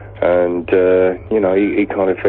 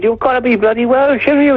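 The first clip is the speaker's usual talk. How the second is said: very high, insistent mock Welsh; Welsh accent. Welsh local insists the musician is Welsh, Welsh accent. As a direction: insist in a squeaky Welsh caricature. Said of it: "when we play in Wales".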